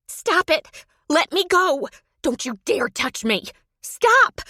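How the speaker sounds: high, loud terrified shrieks; rising panicked shrieks of a woman being grabbed; shrieking. Acted. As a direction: scream for help in panic. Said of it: "she shrieked".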